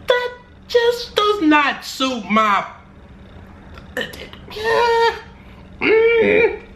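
slow, high, queasy strain into retching; hammed-up nausea, fighting it down then heaving; about to vomit. Weak-stomached skydiver imagining the jump, mock retching. Acts out being sick skydiving.

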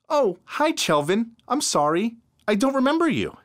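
put-on sweet, sorry young woman. A narrator's reading. Narrator voices the amnesiac girl.

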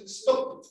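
"stop" unvoiced, with a clear closing P; whispered stop. Shown as a demonstration. Teacher models "stop" for the students. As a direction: breathe "stop" out with the lips shut at the end. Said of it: "Y después de eso le ponen una T".

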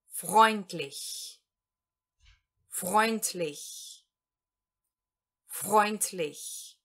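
freundlich said slowly, FROYNT-lish, falling; German word freundlich. Shown as a demonstration. Teacher models freundlich for Portuguese learners, slow and clear. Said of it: "tudo isso vai ser pronunciado".

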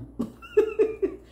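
very high squeaky giggle. Real, not acted. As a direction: titter at your own impression. Amused at own impression.

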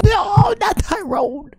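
high, slow dismayed shout; disappointed shout. Real, not acted. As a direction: wail the no. Wheel lands wrong.

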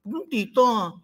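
mimicking sweet-talker. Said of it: "Boses mo lang kay Mami Merly".

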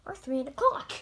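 ordinary delivery

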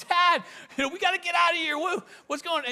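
high-pitched, acted panic; freaking out. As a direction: imitate a panicking son urging his dad to leave. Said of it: "my brother's freaking out".

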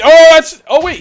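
loud, high yelp of alarm; real jolt of surprise, voice jumping up; startled outburst. Real, not acted. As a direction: caught off guard mid-word. Big enemy suddenly attacks him.

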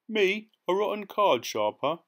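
high, loud outraged protest; indignant cry. A narrator's reading. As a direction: cry out, offended at the insult. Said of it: "he cried".

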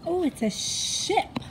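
ordinary delivery

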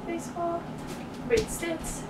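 ordinary talk

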